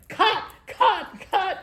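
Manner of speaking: high shouted call to stop; a mock director shouting cut. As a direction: yell out to halt the take. Recording man calling to stop.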